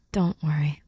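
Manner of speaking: normal talking voice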